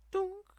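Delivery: high sung dunk jingle; achievement sound effect. Retold or imitated. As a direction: chime it like a game achievement. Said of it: "somebody just unlocked an achievement".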